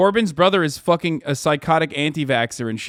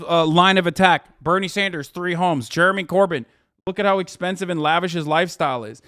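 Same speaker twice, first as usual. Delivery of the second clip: ordinary delivery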